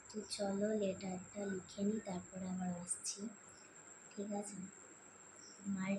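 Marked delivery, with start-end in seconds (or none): none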